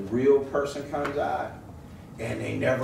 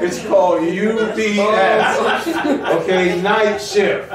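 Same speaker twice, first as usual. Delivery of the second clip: laughing; 6 semitones higher, 10 dB louder, slower than usual. Real, not acted. Laughing while telling UPS story.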